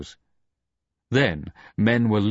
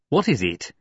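snapping. A narrator's reading. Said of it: "Egmont snapped".

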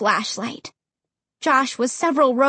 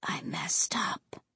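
hushed unvoiced whisper; 7 semitones lower, 13 dB quieter, slower than usual. A narrator's reading. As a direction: whisper it in regret. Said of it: "he whispered".

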